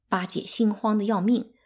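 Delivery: ordinary delivery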